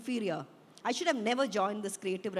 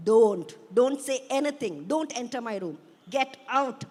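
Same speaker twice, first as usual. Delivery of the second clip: raised voice, loud on the first warning; high-pitched angry yell; 7 dB louder than usual. A narrator's reading. Daughter yelling at her parents to leave her room, angry. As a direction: order them out, moderately yelled. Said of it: "I yell, my eyes blazing, my voice high-pitched".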